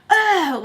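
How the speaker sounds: loud, high cry of disgust; imitated recoil, loud ugh. Woman being spat on, imitated disgusted ugh. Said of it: "like, going like that".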